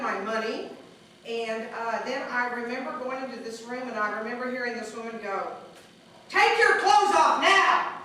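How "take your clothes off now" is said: loud, high, slow command; barked order. Retold or imitated. Jail officer ordering the prisoners, loud barked command. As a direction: bark it like a mean jail officer. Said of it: "she's looking real mean".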